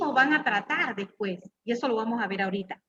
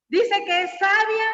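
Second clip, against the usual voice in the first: higher, slow and sarcastic, louder; mocking; 7 semitones higher, 4 dB louder, slower than usual. Preacher mocks the wife who calls herself wise, sarcastic. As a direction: scoff at her claim to be wise.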